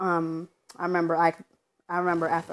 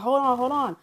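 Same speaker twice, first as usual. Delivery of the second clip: higher, louder mimicked 'hold on'; raised voice acting out an interruption; urgent retold interjection; 8 semitones higher, 6 dB louder than usual. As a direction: imitate someone urgently cutting into a fight. Daughter interrupting the fight, retold.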